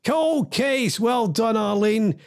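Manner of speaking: high, drawn-out winner announcement; triumphant reveal. Real, not acted. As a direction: proclaim the answer with delight.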